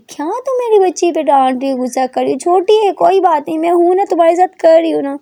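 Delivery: high, loud protective scolding; aunt scolds her sister for scolding her girl. A narrator's reading. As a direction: stick up for the niece. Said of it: "अपनी बहन को डांटती".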